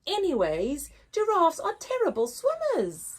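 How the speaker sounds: mean crocodile. Acted. Crocodile mocking giraffe swimming, sneering. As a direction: sneer like a nasty croc. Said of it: "snapped a mean-looking crocodile".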